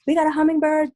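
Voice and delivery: high-pitched excited announcement to the household; super excited. Retold or imitated. Telling of a first hummingbird, super excited. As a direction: imitate yourself excitedly calling everyone to look. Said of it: "I'm super excited".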